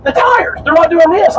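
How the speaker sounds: high, mock-exasperated retold tourist; exasperated insistence. Lady repeats it to baffled captain, exasperated insistence.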